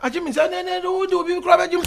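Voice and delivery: high, fast, loud objection; stumbling high-pitched outburst; heated protest. Acted. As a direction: shout it out in a rush.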